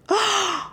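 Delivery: sharp high gasp of wonder; amazed gasp. A narrator's reading. Girls gasping at the giant skeleton. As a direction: gasp at the sight.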